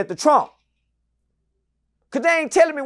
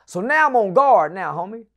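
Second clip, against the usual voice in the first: ordinary talk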